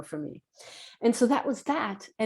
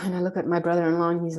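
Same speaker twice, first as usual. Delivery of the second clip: ordinary talk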